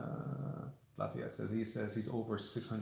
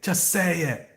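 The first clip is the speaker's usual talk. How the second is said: louder, higher insistence; emphatic repeat; 9 semitones higher, 9 dB louder, slower than usual. Retold or imitated. Repeating his own motto, now emphatic. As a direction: push it harder the second time. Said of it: "I've always said".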